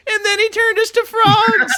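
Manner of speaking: squeaky high little frog telling its story. Acted. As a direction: talk like a tiny frantic frog, way up high. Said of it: "They're like".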